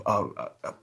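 normal talking voice

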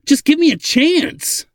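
very high, loud, slow plea; pleading. A narrator's reading. Ladin begs the old man for a chance, pleading. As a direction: beg desperately, stretching the words high. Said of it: "Ladin pleaded again".

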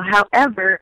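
usual speaking voice

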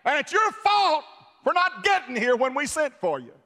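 high, loud blaming voice; Martha's accusation. Acted. Martha accuses Jesus at the tomb, high loud blame. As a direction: throw the blame at him, pitched high. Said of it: "'cause she said".

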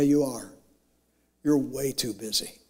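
ordinary delivery

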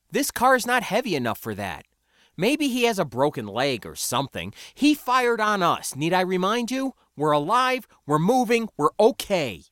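arguing. A narrator's reading. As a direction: build the case, voice climbing with each point. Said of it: "I argued".

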